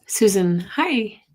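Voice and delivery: ordinary talk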